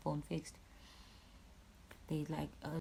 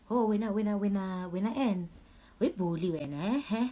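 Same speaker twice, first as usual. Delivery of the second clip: making fun; 3 semitones higher, 5 dB louder than usual. Retold or imitated. Cops teasing a bruised victim, making fun. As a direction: imitate jeering cops, teasing sing-song lift. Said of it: "started making fun".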